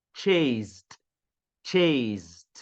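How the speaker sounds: "Chased, chased" with a buzzing z before the last consonant; Z before ending. Shown as a demonstration. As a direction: drill "Chased, chased" slowly apart, as "cheyzd". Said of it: "Então, som de Z".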